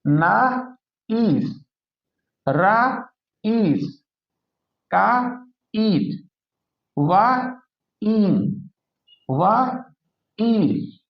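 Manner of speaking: slow split syllables, ना ईस with a long ई; teacher stretching the long ई sound; vowel drawn out. Shown as a demonstration. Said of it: "जिस तरह".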